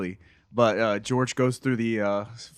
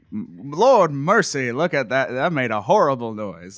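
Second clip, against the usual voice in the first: put-on Southern accent. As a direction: imitate a Southern guy, stretching the opening words. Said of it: "like a Southern guy".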